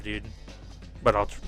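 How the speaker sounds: ordinary talk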